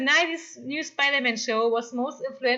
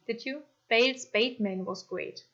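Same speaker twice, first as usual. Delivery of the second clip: ordinary delivery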